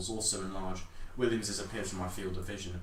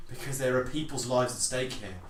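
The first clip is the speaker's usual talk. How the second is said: louder, higher heated reply; heated retort; 4 semitones higher, 6 dB louder than usual. Acted. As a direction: snap back under pressure. Heated argument over the artifact.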